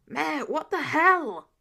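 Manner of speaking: exasperated yell across the office; slow, loud shout of disbelief. Acted. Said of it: "a new voice yelled back".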